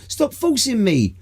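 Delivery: drawn-out high wail; mock protest. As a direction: cry out in hammed-up protest, high and loud. Acted protest at being forced.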